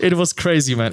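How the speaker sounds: real laugh through the words. Real, not acted. Telling the host it was crazy, laughing. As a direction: laugh it out, still amazed. Laughs recalling his success.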